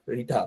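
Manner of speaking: রিঠা with a hard, aspirated English t; hard puffed t in রিঠা; English pronunciation. Retold or imitated. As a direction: softly repeat রিঠা the English way. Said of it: "ইংরেজরা উচ্চারণ করে রিঠা".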